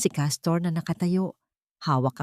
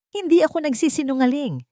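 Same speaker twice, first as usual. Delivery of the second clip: insisting; 9 semitones higher than usual. A narrator's reading. Mother insisting to the disbelieving father, raised voice. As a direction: insist she is not lying. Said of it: "git ni Aluncina".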